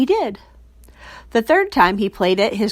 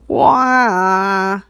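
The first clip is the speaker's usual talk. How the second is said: a whinny that comes out like a large dog's bark. A narrator's reading. Boy taking his turn at whinnying, sounds like a dog. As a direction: bark it like a big dog trying to neigh. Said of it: "more like a large dog".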